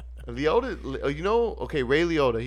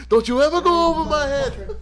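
high, loud mock gangster scolding; hammed-up mob boss tirade; De Niro impression; 13 semitones higher, 8 dB louder than usual. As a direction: snap it like a furious mob boss, pitched way up. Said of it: "that's a great scene, too".

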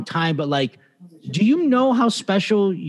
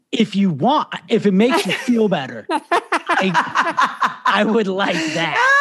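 high, laughing, slowed "I would like that"; trying to play it cool while laughing; laughing eager yes; 6 semitones higher, 4 dB louder, much slower than usual. Retold or imitated. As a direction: fake politeness cracking into laughter. Eagerly accepting the coveted poster.

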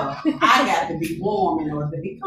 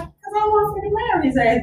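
sassy retort; 11 semitones higher than usual. Retold or imitated. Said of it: "I was like".

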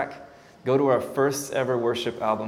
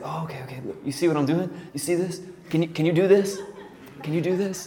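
excited, higher, sped-up coaching with a laugh; eagerly egging the kid on; eager pastor coaching; 6 semitones higher, faster than usual. Retold or imitated. Pastor urging the shy kid to copy him, excited and higher. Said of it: "and he's like".

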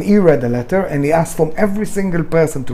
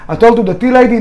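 ordinary talk, nothing marked

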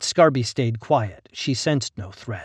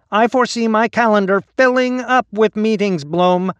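whiny voice; 10 semitones higher, 8 dB louder than usual. A narrator's reading. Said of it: "a repellent whine".

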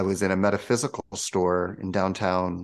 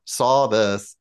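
ordinary talk, nothing marked

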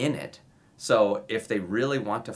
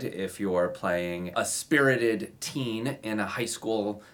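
ordinary talk, nothing marked

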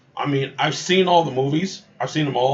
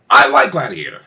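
loud; 2 semitones lower, 12 dB louder than usual. Real, not acted. Bursts out defending the film.

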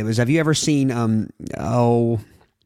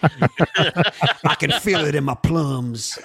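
Will Ferrell impression; 3 semitones higher, much slower than usual. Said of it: "Will Ferrell plays that guy".

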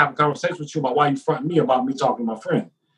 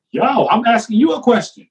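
much higher, incredulous protest; exasperated plea; 9 semitones higher than usual. Retold or imitated. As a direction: plead to be treated like a person, voice pitched up. Acting his plea to officer.

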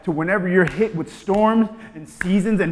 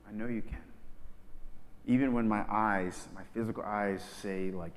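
soft, much lower, intimate; quiet earnest prayer; 9 semitones lower, 12 dB quieter than usual. Shown as a demonstration. Hurting believer praying softly to God. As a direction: pray it almost under your breath. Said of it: "It's like".

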